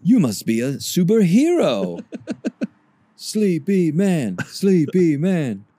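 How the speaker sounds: high slow superhero chant; high hero announcer. Acted. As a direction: sing the hero's name.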